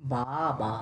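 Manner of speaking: slow, low বাবা with both syllables stretched. Shown as a demonstration. Teacher splitting a word into syllables, slowed down. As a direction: draw out বা-বা with a gap between. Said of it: "slow কর".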